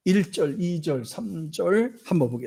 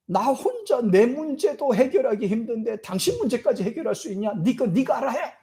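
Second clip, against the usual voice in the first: brushing him off in a much higher voice; dismissive retort; 7 semitones higher than usual. Pretend. An ordinary man refusing the thief, dismissive high retort. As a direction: wave him away, annoyed and high. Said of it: "일반 사람 같으면 그렇게 나오겠습니까?".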